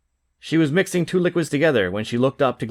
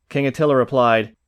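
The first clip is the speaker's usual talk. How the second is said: ordinary delivery, nothing marked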